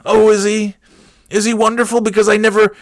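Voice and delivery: high-pitched feigned amazement; sarcastic mock surprise. Son answering a church lady, sarcastic. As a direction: play astonished, as if it is news.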